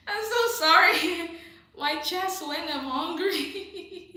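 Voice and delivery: high, loud, drawn-out words through laughter; laughing. Real, not acted. Host apologizing to viewer sent to a shrink, laughing. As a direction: squeal it out slowly while cracking up.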